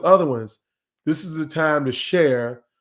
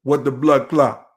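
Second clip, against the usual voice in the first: put-on Jamaican exclamation; imitated Jamaican accent; 4 dB louder than usual. Shown as a demonstration. Trainer shows how people imitate Jamaicans, mock Jamaican accent. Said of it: "when you imitate Jamaican accents".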